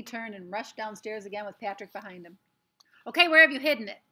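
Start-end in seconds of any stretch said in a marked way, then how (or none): 3.1-4.0 loud, high, furious yell; a boy bellowing at his brother; angry shout. A narrator's reading. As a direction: shout it as you burst in. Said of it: "he shouted".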